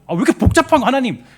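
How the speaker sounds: loud, high protest to God; acted complaint. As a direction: act out an exasperated grumble at full voice. 하나님께 따지는 불평을 연기. (하나님께 큰 소리로 투덜대는 말투)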